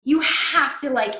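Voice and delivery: usual speaking voice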